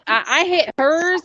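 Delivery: ordinary talk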